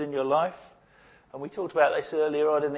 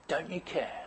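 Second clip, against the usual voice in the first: very soft, bare question to God; almost whisper the hurt; hushed despairing plea; 14 dB quieter than usual. Retold or imitated. Abuse survivor asking God, very soft plea.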